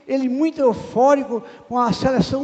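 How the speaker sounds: normal talking voice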